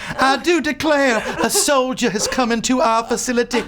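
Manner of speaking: a hammed-up Southern belle offering to bathe a soldier. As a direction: play the coy Southern seductress with a laugh in it. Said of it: "I believe I could be a Southern seductress".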